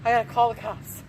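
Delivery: loud, urgent, out-of-breath blurt; panicked farmer. Acted. Farmer bursts in to his wife, loud and panicked. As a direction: blurt it out in a frightened rush.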